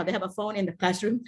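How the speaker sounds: ordinary talk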